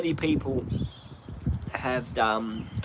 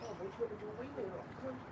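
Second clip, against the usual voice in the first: high coaxing voice heard from across the yard; far off doggy talk; 7 semitones higher, 18 dB quieter, faster than usual. Real, not acted. Owner away from the mic coaxing his dog to wee, high and far off. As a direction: call the dog gently from a distance.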